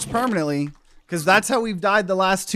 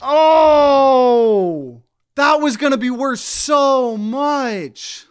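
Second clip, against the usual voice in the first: dismayed lament; 6 semitones higher, 5 dB louder, much slower than usual. Real, not acted. Laments the lost treasure.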